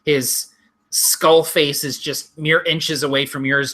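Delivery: ordinary talk, nothing marked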